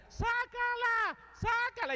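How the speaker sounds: high, drawn-out crowd shout of sácala; crowd yell. Retold or imitated. Crowd egging on the stone thrower, long high shout. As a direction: bellow it like a whole crowd egging on a fighter. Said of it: "La gente decía era".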